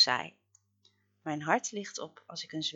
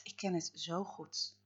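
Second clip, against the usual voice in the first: ordinary delivery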